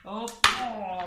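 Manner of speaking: usual speaking voice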